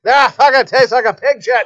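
gruff hammed-up Irish uncle. Uncle eating a raw potato, mock Irish accent. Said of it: "I'm like".

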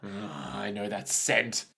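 very low, hushed mock-dramatic voice; soft, deep, brooding parody; disgusted doctor-hater. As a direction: mutter it low, with theatrical disgust. Joins in acting the doctor-hater.

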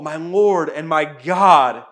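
loud, slow exclamation of awe; exclaimed. A narrator's reading. Thomas cries out to the risen Jesus, loud and slow. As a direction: proclaim it slowly and loudly. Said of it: "Thomas exclaimed".

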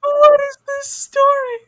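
very high, loud, helpless squeal; laughing disbelief. Real, not acted. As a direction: wail it in incredulous laughter. Listener cracks up in disbelief.

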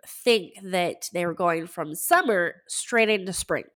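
normal talking voice

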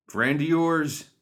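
high, slow drawled outlaw taunt; gruff outlaw voice. Acted. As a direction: tease him like a rough gang member. Mad Dog character voice.

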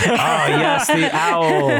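high, slow laughing drawl. Real, not acted. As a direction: laugh the words out. Group laughing about the owl.

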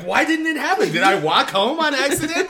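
very high, loud mock bewilderment; squeaky, hammed-up confusion verging on laughter; clueless Andy. Mocking Andy who has no clue what happened, high mock-baffled. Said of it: "he has no idea".

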